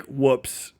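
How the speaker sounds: ordinary delivery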